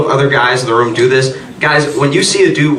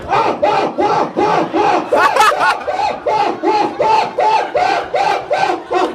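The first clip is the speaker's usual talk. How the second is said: very high repeated ah yelps; shrill panicked shrieks. Retold or imitated. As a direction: shriek in disgust over and over. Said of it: "so he's like".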